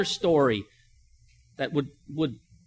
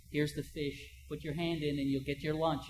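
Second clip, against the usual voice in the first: a softer, sly coaxing voice, lightly voiced; sly father; 9 dB quieter than usual. Cruel father coaxing his child to reach in, softly sly. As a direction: lure the child in quietly, a hint of a trick.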